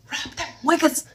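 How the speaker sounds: very high falsetto exclamation; cartoonishly high hype voice; mock cheer. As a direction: squeal it out in playful approval. Mock cheer for the scheming woman.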